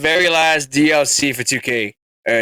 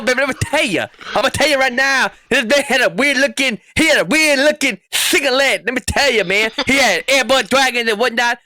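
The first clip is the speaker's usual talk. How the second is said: raised, drawling wrestling promo voice; Dusty Rhodes impression; 4 semitones higher than usual. Host hamming up a wrestling promo for co-hosts. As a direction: cut a promo like the American Dream. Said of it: "trying to do a Dusty Rhodes impression".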